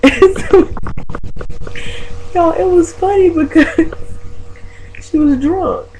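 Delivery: high-pitched, talking through laughter; laughing. Real, not acted. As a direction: crack up while telling the story. Laughs telling the funny part.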